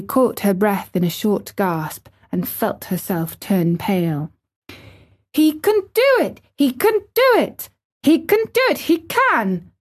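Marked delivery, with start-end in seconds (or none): 5.3-9.7 very high, anxious chant; urgent repeated words in a high voice; frantic gabble. A narrator's reading. Pale girl gabbles to herself for the boy, frantic. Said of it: "she garbled over to herself under her breath as fast as she ever could".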